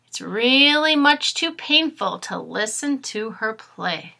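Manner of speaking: lively storybook read-aloud; reading to children. Acted. Teacher reading a storybook aloud to her class, lively. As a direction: read it brightly to young listeners. Reads picture book to class.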